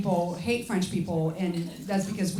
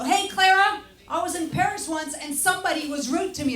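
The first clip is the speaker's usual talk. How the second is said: imitated accuser; 7 semitones higher than usual. Someone accosts the French comedian about Paris, mock complaint in raised voice. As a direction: pipe up like an aggrieved acquaintance.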